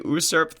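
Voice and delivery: "usurp" with an opening "oo" sound; mispronounced word. Real, not acted. Host reading the plot summary aloud, mispronounced "usurp". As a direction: say "usurp" as "oo-surp". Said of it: "tell you that it's pronounced usurp".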